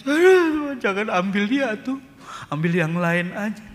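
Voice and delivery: high, loud tearful plea; wife pleading. Retold or imitated. Preacher imitating his wife's loud, high plea not to take him. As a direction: cry out begging God to spare him. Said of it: "Istri saya nangis".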